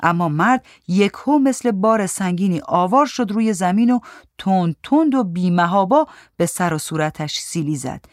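ordinary delivery, nothing marked

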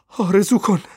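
high, loud command; very loud shout. A narrator's reading. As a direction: shout the order at a high pitch. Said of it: "با صدایی بسیار بلند فریاد کشید". (فریاد بسیار بلند)

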